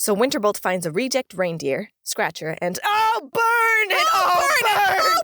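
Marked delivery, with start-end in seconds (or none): 2.8-5.2 high-pitched shrieking panic; hammed-up cries of revulsion; mock horror. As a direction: shout and wail in exaggerated fright. Hammed-up horror at the rat creature.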